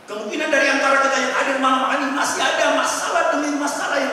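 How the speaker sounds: loud, emphatic sermon voice; fervent preaching. Acted. Pastor urging the congregation to believe, loud and fervent. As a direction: drive the faith home to them.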